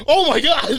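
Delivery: very high, loud retold disbelief; hammed-up squeal of astonishment; mock shocked outburst. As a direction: replay your shock at an outrageous joke. Acting out reaction to the joke.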